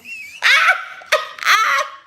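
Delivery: a high, loud burst of real laughter. Real, not acted. Viewer's story about a fake cop, high cackling laugh. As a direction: lose it laughing at the lie. Cracking up at the officer claim.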